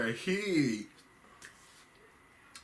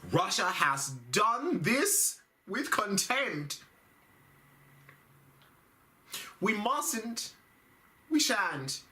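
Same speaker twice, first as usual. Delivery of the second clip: Theresa May impression; 9 semitones higher than usual. Comic imitating Theresa May's speech condemning Russia. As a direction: make a grand formal British speech. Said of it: "up there saying".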